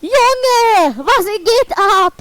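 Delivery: astonished at the video. Real, not acted. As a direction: cry out in disbelief. Astonished reaction.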